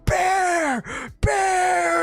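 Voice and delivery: loud high shout of warning; shouting bear to friends in the game, loud and high; retold yelling. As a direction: yell it out, panicked. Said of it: "I'm yelling".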